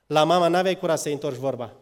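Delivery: ordinary talk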